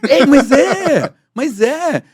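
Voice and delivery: high, loud, drawn-out hail; big laughing call-out. Shown as a demonstration. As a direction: holler to a famous pal. Demonstra chamar a atenção do [name].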